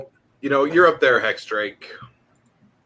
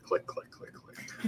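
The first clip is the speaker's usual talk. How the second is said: whispered clicks; 19 dB quieter, much slower than usual. Pretend. Imitating a camera sound.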